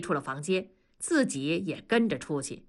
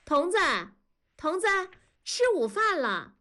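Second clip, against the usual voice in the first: calling from outside; 10 semitones higher than usual. Acted. 女人在门外喊.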